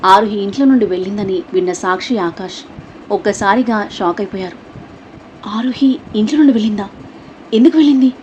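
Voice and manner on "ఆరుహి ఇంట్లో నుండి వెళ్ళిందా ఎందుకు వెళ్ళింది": anxious questions rising higher and louder; pleading sister, alarmed; worried sister asking her brother why she left, anxious; tense, worried. A narrator's reading. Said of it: "అంది సాక్షి టెన్షన్గా చూస్తూ".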